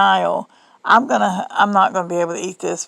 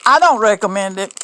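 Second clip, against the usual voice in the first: normal talking voice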